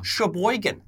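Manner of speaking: Sheboygan with a broad Midwestern vowel; Midwestern accent. Shown as a demonstration. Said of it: "with a Midwestern accent".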